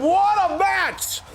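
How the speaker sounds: high, drawn-out victory shout; triumphant announcer call. Real, not acted. Gamer announcing the new Trios champions, triumphant shout. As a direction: crown the champions like a ring announcer.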